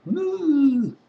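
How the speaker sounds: loud, high whooping cheer; woo cheer. Real, not acted. Tired sports nut signing off with a loud whoop. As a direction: let out a big excited whoop.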